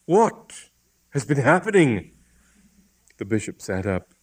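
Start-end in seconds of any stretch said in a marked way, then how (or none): 0.0-2.1 raised, higher-pitched demand; urgent, louder question; anxious cry. A narrator's reading. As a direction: cry out for an explanation. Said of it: "he cried".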